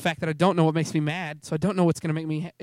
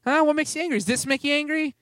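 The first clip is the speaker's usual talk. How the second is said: higher, teasing needling; playful poking; 8 semitones higher than usual. Retold or imitated. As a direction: lightly goad with question after question. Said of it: "God started poking me".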